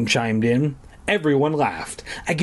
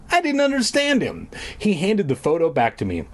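normal talking voice

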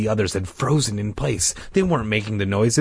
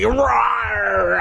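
higher playful beast roar; animal roar; 11 semitones higher than usual. Pretend. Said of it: "I'm an animal".

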